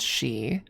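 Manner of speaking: "she" said as the Gaelic sidhe; Gaelic pronunciation. Shown as a demonstration. Streamer explaining the Gaelic spelling of banshee, Gaelic pronunciation. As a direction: say "she" with a plain sh and long ee. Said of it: "S I D H E is pronounced".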